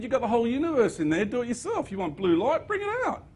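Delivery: a high, breezy celestial voice telling him to do it himself; archangel's reply. Retold or imitated. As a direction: play the archangel tossing the question back, high. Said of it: "He said".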